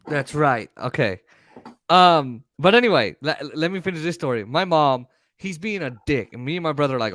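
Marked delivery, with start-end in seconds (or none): none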